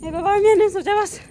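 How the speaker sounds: loud, high "oh god" exclamation; startled gasp of fear after the crack; frightened cry. Real, not acted. Startled by thunder in the field, a loud frightened cry.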